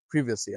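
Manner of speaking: প্রিভেসি with a short "pri", "PRIV-uh-see"; British privacy. Shown as a demonstration. Teacher sums up the British প্রিভেসি for viewers. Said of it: "British অ্যাকসেন্টে আসলে এটা".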